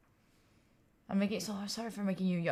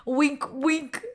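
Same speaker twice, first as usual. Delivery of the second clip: mock wink; 7 semitones higher, 5 dB louder, much slower than usual. Teasing joke about chat.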